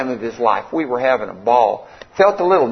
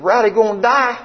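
high-pitched, incredulous question; retold shock, voice pitched right up; 7 semitones higher than usual. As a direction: ask it in stunned disbelief.

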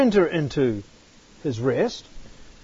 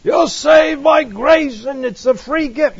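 mock evangelist slogan; 6 semitones higher than usual. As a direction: parrot the easy gospel line brightly. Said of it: "what they want to emphasize".

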